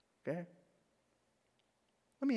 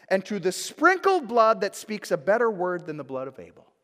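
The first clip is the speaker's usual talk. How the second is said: higher, louder preaching; scripture reading at full force; 7 semitones higher, 12 dB louder than usual. Acted. Preacher reading Hebrews to the church, loud and exalted. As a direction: proclaim the passage with fervor.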